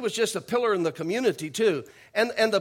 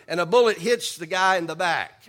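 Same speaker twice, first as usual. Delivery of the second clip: ordinary talk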